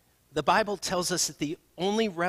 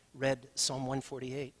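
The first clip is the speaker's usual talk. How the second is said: ordinary delivery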